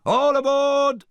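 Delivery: high, loud, slow call; captain's shout. A narrator's reading. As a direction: bellow it across the dock. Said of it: "the captain shouted".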